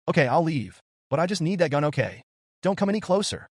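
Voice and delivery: a cornered woman ordering him back; demanding. A narrator's reading. Woman holding a gun demands he come no closer, demanding. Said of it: "she demanded".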